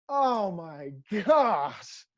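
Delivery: long drawn-out mock groan of dismay; exasperated dismay. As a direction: stretch every word in acted despair. Player dismayed by growing punishment.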